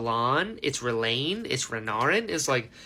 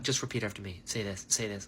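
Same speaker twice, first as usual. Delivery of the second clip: much softer, low and hushed, like a voice in the head; quiet coaching voice; 10 semitones lower, 11 dB quieter, faster than usual. Retold or imitated. The voice in Szeth's head coaching him, hushed and quiet. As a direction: murmur the instructions softly.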